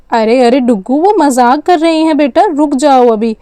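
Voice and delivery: ordinary delivery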